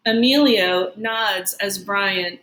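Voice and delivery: ordinary delivery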